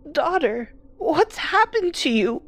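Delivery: high, thin alarmed mother voice; the fond mother turns fearful; fearful elderly woman; frightened old mother. Acted.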